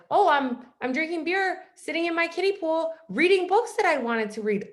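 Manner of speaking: breezy delight. Retold or imitated. Bragging about her carefree pause.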